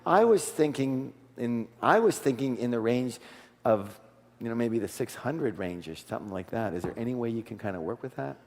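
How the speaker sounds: downplay the offer so nobody feels pushed; soft and humble. Shown as a demonstration. Coach showing the buyer's humble counteroffer, soft and low. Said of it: "listen to the way I do it".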